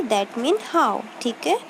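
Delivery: ordinary talk, nothing marked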